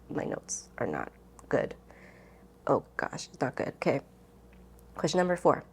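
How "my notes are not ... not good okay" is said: whispered, embarrassed aside; muttering to herself. Real, not acted. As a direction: breathe it under your breath, a little mortified. Embarrassed at her messy notes.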